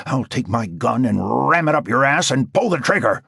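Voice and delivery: furious yelling threat; roaring rage. A narrator's reading. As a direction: roar your threats into the phone. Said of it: "Wigmore roared into the phone".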